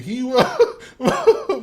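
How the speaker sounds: words broken by laughter; can't get it out for laughing. Real, not acted. Laughing while telling story.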